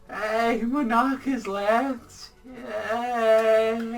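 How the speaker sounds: high, very slow sarcastic celebration; mock cheer. Mocking Monarch's exit, high slow sarcastic yay. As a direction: drag out a fake hooray.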